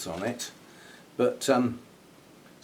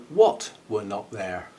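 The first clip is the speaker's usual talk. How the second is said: normal talking voice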